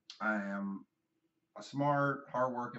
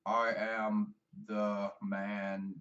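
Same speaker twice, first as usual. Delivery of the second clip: very slow, lower, wooden delivery; charisma-free wrestler promo; 4 semitones lower, much slower than usual. Retold or imitated. Mocking wrestlers with no promo presence, stilted and charisma-free. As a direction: imitate a wrestler who cannot talk, word by word. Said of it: "no charisma".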